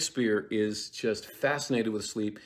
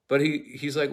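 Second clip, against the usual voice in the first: ordinary delivery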